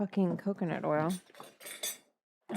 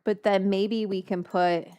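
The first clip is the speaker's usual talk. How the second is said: ordinary talk, nothing marked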